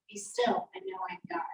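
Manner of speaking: whispered, breathless and reverent; hushed voice of God. Acted. Pastor voicing God to listeners, in a whisper. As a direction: breathe God's command to be still. God's words from the psalm.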